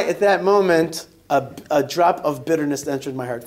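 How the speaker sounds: ordinary delivery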